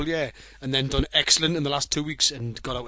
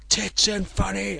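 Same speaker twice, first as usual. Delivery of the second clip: bad Japanese accent; 6 semitones higher, slower than usual. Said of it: "imitating, uh, Japanese accent badly".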